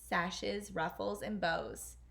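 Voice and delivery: plain reading voice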